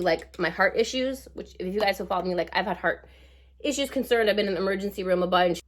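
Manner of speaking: ordinary delivery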